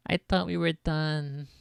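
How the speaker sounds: usual speaking voice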